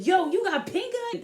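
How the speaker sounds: high startled mock question; imitating alarmed friend. Friend Tasia backing away, high startled mock question. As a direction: recoil and blurt it out. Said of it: "backed up and said".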